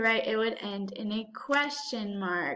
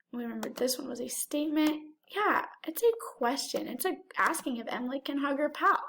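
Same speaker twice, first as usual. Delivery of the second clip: ordinary talk, nothing marked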